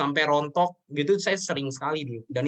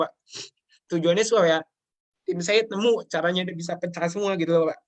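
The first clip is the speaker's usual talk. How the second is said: higher, emotional, with halting pauses; real tearful; 3 semitones higher than usual. Real, not acted. Guest explaining the wish to help the team, tearful. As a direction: plead earnestly while holding back tears. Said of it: "saya cengeng".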